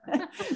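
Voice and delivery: short high laugh; a quick amused laugh; light laugh. Real, not acted. Laughs at own teasing remark.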